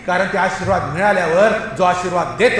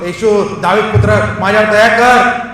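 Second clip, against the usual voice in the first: raised-voice plea, building in loudness; moderately loud beggar's call; crying out; 3 semitones higher, 8 dB louder than usual. Acted. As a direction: call out for mercy over a crowd. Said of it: "तो जोराने ओरडून म्हटलं".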